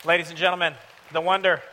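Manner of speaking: building from measured to loud, high hype; big arena-style build-up to the guest's name; announcer introduction. Real, not acted. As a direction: announce the headliner, rising to a shout.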